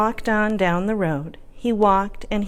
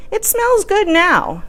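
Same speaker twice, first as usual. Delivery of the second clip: put-on young man voice; 10 semitones higher, slower than usual. Hungry young man answers.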